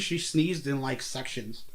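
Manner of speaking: usual speaking voice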